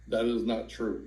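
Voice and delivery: ordinary talk, nothing marked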